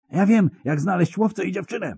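high, desperate shriek. Acted. Terrified spy shrieking at his captors, desperate. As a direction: scream out the secret in terror. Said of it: "wrzasnął".

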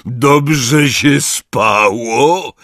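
loud, very slow, drawn-out question; brisk hearty greeting. A narrator's reading. Hagrid asking the waking giant, loud and cheerful. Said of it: "zapytał Hagrid raźnym głosem".

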